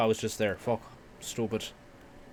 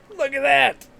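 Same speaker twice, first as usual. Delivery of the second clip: excited; 7 dB louder than usual. Real, not acted. Excited at sight.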